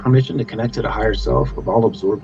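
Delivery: calm, measured prayer voice; healing invocation. Acted. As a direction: intone it like a ritual.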